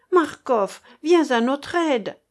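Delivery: high, loud, urgent call; calling for help. A narrator's reading. Keinec begs Marcof to come to their aid, calling out.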